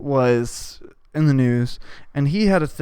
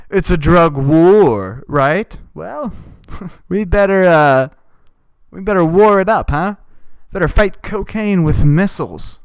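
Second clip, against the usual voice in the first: louder mocking drawl; loud sarcastic parody; mocking commenter; 5 dB louder, slower than usual. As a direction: ridicule the commenter loudly. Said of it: "You just".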